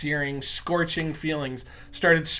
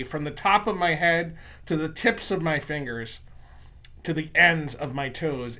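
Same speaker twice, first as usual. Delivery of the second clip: usual speaking voice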